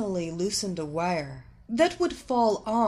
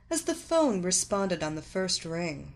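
plain reading voice

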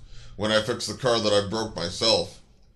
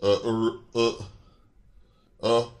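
low, halting stammer; broken, hesitant false starts in a deep voice; 9 semitones lower, 4 dB louder than usual. A narrator's reading. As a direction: stammer as the caught-out mechanic. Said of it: "stammered the mechanic".

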